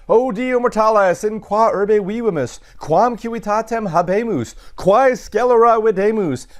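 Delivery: oratorical declamation. Acted. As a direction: declaim like an orator appealing to the gods. Declaims Cicero's exclamation in Latin.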